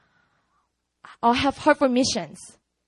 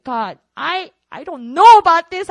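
frustrated plea, bursting louder at the end; 4 semitones higher, 10 dB louder than usual. Retold or imitated. Frustrated student praying through the service, exasperated. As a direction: voice doubt to God, building in force. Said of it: "I was frustrated".

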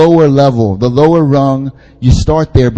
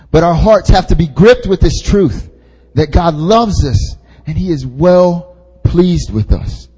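ordinary talk

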